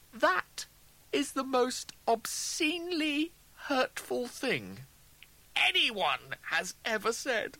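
high, slow, indignant protest; wounded outrage. A narrator's reading. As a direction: draw out each phrase in disbelieving offence. Rimmer outraged at double's insult.

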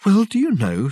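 high, loud opening; musing Reg figure. A narrator's reading. Reg answering Dirk round the door, musing aloud. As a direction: pipe up as if just remembering.

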